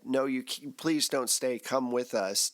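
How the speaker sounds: ordinary talk, nothing marked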